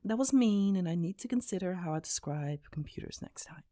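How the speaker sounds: squeaky, flustered apology fading to quiet; apologetic young gamer. Acted. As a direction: backpedal fast, then apologise meekly.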